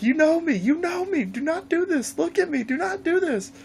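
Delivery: high, frantic begging cry; pleading victim voice. Retold or imitated. Trapped player begging Jason to spare him, crying high. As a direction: squeal for mercy like a doomed camper.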